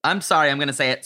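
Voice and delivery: ordinary talk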